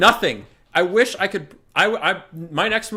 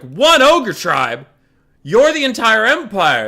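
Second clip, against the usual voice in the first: louder and slow, disbelieving; incredulous louder comeback to lazy allies; exasperated retort; 6 semitones higher, 8 dB louder, slower than usual. Retold or imitated. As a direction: hammer his disbelief at them. Himself retold, exasperated.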